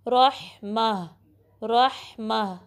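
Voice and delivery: slow रहमा, full r and mid-throat ha; measured रहमा with heavy opening. Shown as a demonstration. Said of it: "रा को heavy पढ़ना है".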